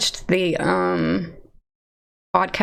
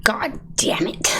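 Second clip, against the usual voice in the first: slow, whispered curse; frustrated whisper; 3 semitones higher, slower than usual. Real, not acted. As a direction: curse under your breath at a botched take. Frustrated at botched impression take.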